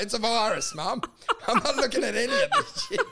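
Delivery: high, fast, laughing denial; laughing mock denial. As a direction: deny it while cracking up. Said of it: "I'm like".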